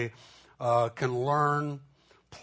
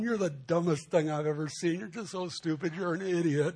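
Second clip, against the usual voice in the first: higher, lilting sweet-talk to a dog; cheerful; 5 semitones higher than usual. Shown as a demonstration. Pastor fondly insulting the neighbour's dog, cheerful. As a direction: coo insults cheerfully, as if praising a pet. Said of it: "you know, cheerfully going".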